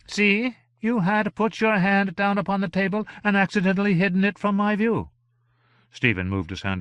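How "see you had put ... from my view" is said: very high, imperious gentleman; theatrical high-pitched gentleman, mildly reproachful; fussy gentleman. A narrator's reading. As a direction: explain fussily in a high, lofty voice.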